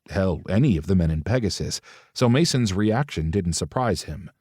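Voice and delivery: ordinary delivery, nothing marked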